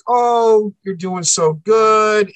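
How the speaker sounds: high, slow, sugary parent voice, imitating; a put-on coaxing tone to win the boy over; mock sweet praise. Parents' sudden sweet praise retold.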